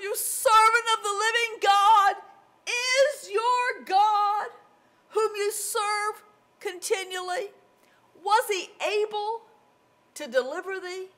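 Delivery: loud, high, distraught calling that eases toward the end; upset, lamenting cry. Acted. King asking Daniel whether God saved him, acted distress. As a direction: wail the question down into the pit. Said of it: "His voice was just all upset".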